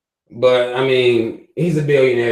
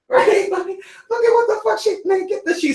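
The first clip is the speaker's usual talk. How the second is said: much higher scornful squeal; high mocking voice. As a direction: squeal in mock disbelief. Mocking a slip on stage.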